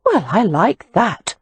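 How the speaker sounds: slow, offended retort; mildly indignant sister. A narrator's reading. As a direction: bristle at the insult. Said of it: "Amelia said indignantly".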